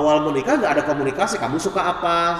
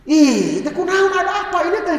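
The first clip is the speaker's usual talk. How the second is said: much higher, louder, fast flustered asking; exasperated husband; 11 semitones higher, 5 dB louder than usual. Retold or imitated. Husband flustered, asking his crying wife what's wrong. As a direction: fire off the worried questions.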